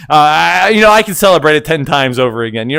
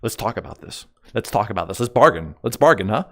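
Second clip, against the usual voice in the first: low, soft negotiating voice; hushed bargaining; 8 semitones lower, 8 dB quieter than usual. Pretend. Streamer trying to bargain with chat, hushed coaxing. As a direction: drop low and soft to coax.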